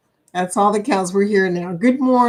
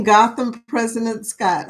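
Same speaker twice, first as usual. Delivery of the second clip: normal talking voice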